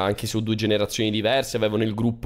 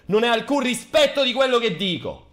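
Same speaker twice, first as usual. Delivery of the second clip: angry outburst; 5 semitones higher than usual. Acted. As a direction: shout it out in exaggerated indignation. Streamer scolds cohost on stream. (sfogo arrabbiato e teatrale)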